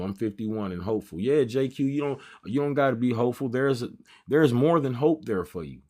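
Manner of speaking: normal talking voice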